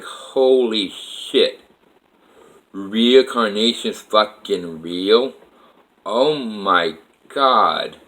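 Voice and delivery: very slow, drawn-out astonishment; stunned disbelief. Retold or imitated. Man seeing Alyssa's profile picture, stunned disbelief. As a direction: stretch each word out, retelling your shock.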